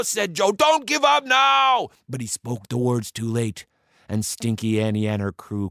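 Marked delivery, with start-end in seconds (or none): 0.5-1.9 loud, pleading battle cry; desperate urging. A narrator's reading. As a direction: holler at the crew to fight on. Joe urges crew on.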